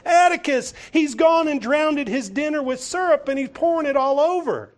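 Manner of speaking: put-on high, loud indignant child telling on him; tattling girl. Scout complains to her father about Walter, high and loud. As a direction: tattle to Dad, high and outraged. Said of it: "she can't be quiet".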